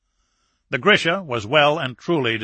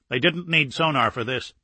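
ordinary delivery, nothing marked

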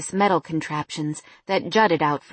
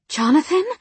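shocked; 8 semitones higher than usual. A narrator's reading. Sudden shock at his voice.

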